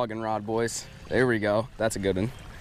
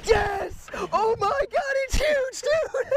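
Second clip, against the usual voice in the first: much higher, overjoyed yelling; ecstatic shouting; slower than usual. Real, not acted. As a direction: scream it out with pure joy. Landing a huge fish.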